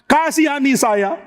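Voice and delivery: high, drawn-out acted wail; tearful pleading. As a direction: beg for mercy as if weeping. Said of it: "Daud menangis di hadapan Tuhan".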